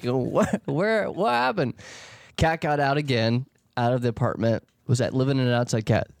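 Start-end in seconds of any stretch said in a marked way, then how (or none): 0.0-1.7 confused cat voice. Retold or imitated. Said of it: "That's what the cat was like".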